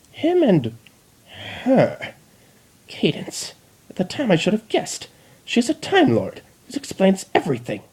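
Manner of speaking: high agitated realisation; frantic rant. Acted. Chrysalis ranting at the Dalek, high and agitated. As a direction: rant as it dawns on you.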